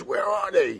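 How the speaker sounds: high, loud urgent question; frantic demand. Retold or imitated. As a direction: mimic a desperate girl demanding an answer. Cousin frantic to find the butts.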